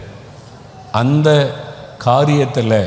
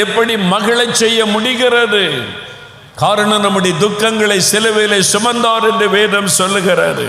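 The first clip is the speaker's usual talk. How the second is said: raised, impassioned sermon voice; fervent preaching; 7 semitones higher than usual. Acted. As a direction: preach it out high and full of fire. Preaching at full force. (உணர்ச்சிமிக்க உயர்ந்த குரலில் பிரசங்கம்)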